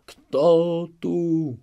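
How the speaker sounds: startled shout. Acted. Chmielnicki wakes in terror, startled shout. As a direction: jolt awake and shout in fright. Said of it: "zakrzyknął".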